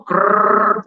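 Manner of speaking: short rattling "krrr"; rolled noise from the throat; imitated throat gurgle. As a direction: mimic a voice failing into a rattle. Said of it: "Suara keluar dari sini".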